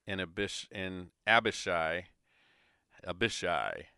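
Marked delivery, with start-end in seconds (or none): 1.3-2.1 Abishai stressed on the first syllable, AB; pronunciation of Abishai. Shown as a demonstration. Reader trying out the name Abishai, AB-uh-shy. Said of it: "I'm gonna say". 3.1-3.9 Abishai as uh-BISH-eye; alternative pronunciation. Shown as a demonstration. Reader trying another Abishai, uh-BISH-eye. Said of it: "I'm gonna say".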